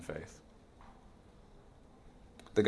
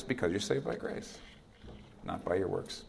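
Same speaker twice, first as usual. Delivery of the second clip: ordinary talk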